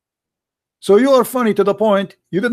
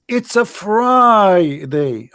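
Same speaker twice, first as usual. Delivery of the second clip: slow drawn-out; much slower than usual. Pretend. Drawn-out mock Friday again.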